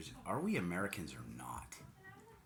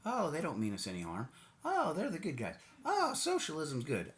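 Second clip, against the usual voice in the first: mock naive believer; 7 semitones higher than usual. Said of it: "those people going".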